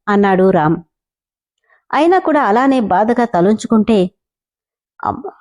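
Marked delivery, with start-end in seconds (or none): 5.0-5.3 sudden surprise. A narrator's reading. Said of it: "సడన్గా నోరు తెరిచేశాడు".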